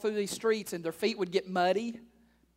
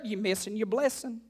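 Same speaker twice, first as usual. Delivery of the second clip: ordinary talk, nothing marked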